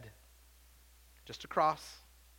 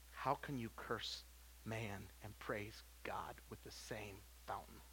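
much softer, low and slow; hushed reading; 7 semitones lower, 13 dB quieter, slower than usual. Acted. Preacher softly reading James to church, hushed. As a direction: let the verse sink in, barely above a breath.

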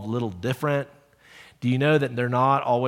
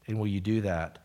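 closing prayer; 4 semitones lower, 7 dB quieter than usual. Real, not acted. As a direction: pray humbly for the congregation.

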